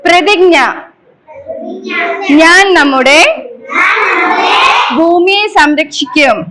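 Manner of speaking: slow, projected recitation in short phrases; leading a pledge. Shown as a demonstration. Teacher leading children in an environment pledge, slow measured call-out. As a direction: call out each phrase firmly, pausing for the echo.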